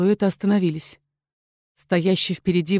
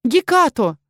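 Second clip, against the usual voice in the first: calling out; 5 semitones higher, 4 dB louder, slower than usual. Acted. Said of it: "Я повторила окрик".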